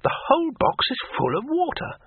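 high, wondering character voice. A narrator's reading. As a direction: quote it in a bright, amazed voice. Character voices his discovery.

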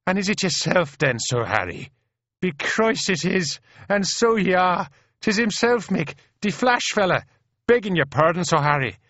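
high, excited Irish brogue; Paddy's excited cry. A narrator's reading. Irish private recognising the famous officer, excited. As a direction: cry out in delighted recognition, pitched right up. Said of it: "cries Paddy".

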